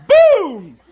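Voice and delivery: a huge, high, laughing boom; loud boom. Retold or imitated. Preacher acting out the father pouncing, a loud laughing boom. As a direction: slam it out like a pounce.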